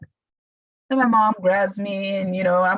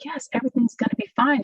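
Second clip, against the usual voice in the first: reassuring friend; 7 semitones higher than usual. Retold or imitated. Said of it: "And she's like".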